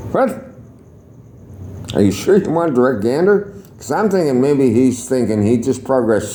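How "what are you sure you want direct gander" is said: incredulous. Retold or imitated.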